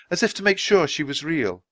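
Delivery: ordinary talk, nothing marked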